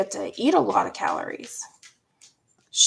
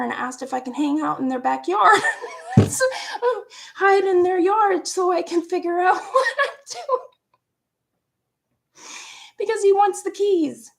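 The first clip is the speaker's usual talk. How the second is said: higher, half-laughing half-crying; laughing through tears; 9 semitones higher than usual. Real, not acted. Telling viewers about hiding in a yard, laughing through tears. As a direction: let the laugh crack into tears. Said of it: "laugh cry heard".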